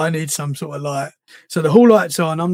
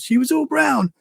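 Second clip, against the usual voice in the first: put-on a little boy reporting what he saw; imitating his son; 8 semitones higher than usual. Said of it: "that was his words".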